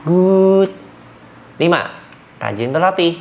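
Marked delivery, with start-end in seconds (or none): 0.0-0.7 high, loud praise word; praising good. Shown as a demonstration. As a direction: sing out good to reward the dog. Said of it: "Good, boleh halus dan datar nadanya".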